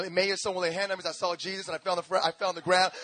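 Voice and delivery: normal talking voice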